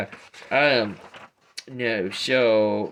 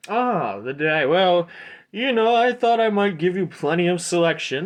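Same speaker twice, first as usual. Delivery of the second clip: high, loud shopkeeper voice; eager merchant Aldor; 8 semitones higher, 7 dB louder than usual. Acted. Aldor answers a customer asking about the dagger, eager merchant. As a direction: pitch it up like an eager salesman.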